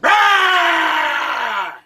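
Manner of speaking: long, loud roaring yell; creature's yell. Retold or imitated. Storyteller imitating the thing outside the tent, loud yell. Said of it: "it resumed its yelling again".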